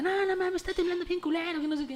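high mock alarm of a neighbour woman. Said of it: "Y la vecina". (vecina alarmada, voz aguda)

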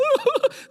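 high comic sob; short high mock boo-hoo howl; imitated crying wail. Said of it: "crying, crying, crying".

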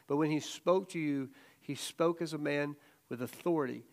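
ordinary talk, nothing marked

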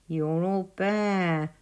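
slow, drawn-out animal taunt; lightly mocking storybook creature; teasing Weasel. A narrator's reading. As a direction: tease slowly in a put-on weasel voice. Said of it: "teased Weasel".

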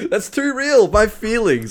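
high-pitched words through laughter; laughing. Real, not acted. Laughing at friend's teasing.

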